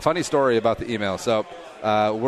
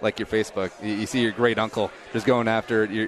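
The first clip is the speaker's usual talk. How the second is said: ordinary delivery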